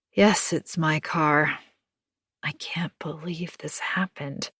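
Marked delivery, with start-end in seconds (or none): 2.4-4.5 soft whisper of disbelief; dismayed whisper. Acted. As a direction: breathe it out, shaken. Anna upset at vandalised car.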